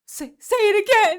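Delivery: almost shouting say it again; urgent plea. Retold or imitated. Doctor urging Samson to repeat.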